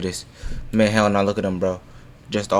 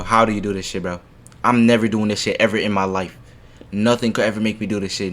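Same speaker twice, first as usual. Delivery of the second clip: ordinary delivery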